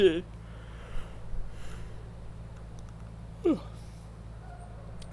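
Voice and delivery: long breathy sigh of exasperation; weary sigh. Real, not acted. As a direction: let out a tired sigh before going on. Reader sighs at the text.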